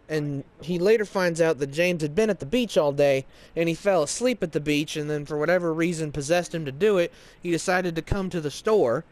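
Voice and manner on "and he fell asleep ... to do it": plain reading voice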